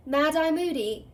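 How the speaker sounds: ordinary delivery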